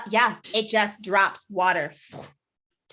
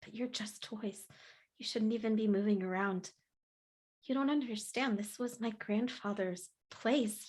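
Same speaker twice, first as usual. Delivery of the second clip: quiet hurt; 2 semitones lower, 13 dB quieter than usual. Acted. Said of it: "she's like".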